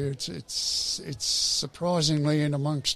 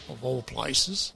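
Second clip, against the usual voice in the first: usual speaking voice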